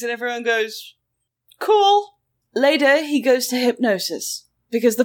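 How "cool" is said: higher, offhand one-word reply; mock nonchalance. Everyone answering the alien witness, breezily underwhelmed. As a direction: brush it off with a lightly mocking air.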